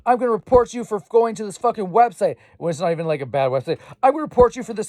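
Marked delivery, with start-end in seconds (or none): 0.0-2.4 high, whiny mock scold; sneering impression of nagging parents. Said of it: "They're just like". 4.0-4.9 high, whiny mock scold; sneering impression of nagging parents. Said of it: "They're just like".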